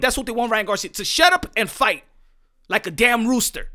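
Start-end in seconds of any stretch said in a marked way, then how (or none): none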